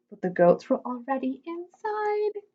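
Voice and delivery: plain reading voice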